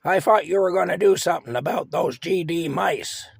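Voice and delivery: loud, high-pitched irritated yell; yelling at husband. Retold or imitated. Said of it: "she yelled at Frank".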